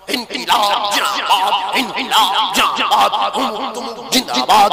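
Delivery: mock a political rally slogan; party slogan. Mimicking party workers at a rally, slow mocking chant. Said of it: "চল, চল, জোরে বল।".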